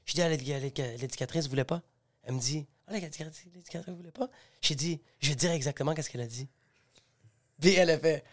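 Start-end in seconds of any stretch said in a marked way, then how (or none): 0.2-6.5 very soft, low dialogue; subdued retold exchange. Explaining the red dress to his girlfriend, subdued. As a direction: retell it in a hushed, low voice.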